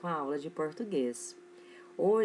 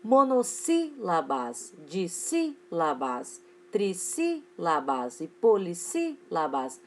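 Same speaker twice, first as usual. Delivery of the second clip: "Monossílabas", "dissílabas" slow, broken up with SÍ standing out; stress on SÍ; much slower than usual. Shown as a demonstration. Teacher showing pupils where the accent falls, stress on SÍ. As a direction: punch the SÍ in "trissílabas" and "polissílabas", spacing the syllables. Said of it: "acento no mesmo lugar".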